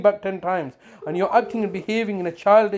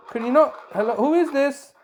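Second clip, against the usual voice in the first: usual speaking voice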